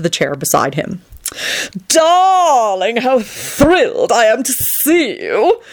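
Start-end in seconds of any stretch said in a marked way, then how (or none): 1.9-5.6 high, drawn-out theatrical gush; shrill delight. A narrator's reading. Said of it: "he shrilled delightedly".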